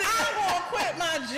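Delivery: high, exuberant mock shout; retold excitement. Retold decision after big check.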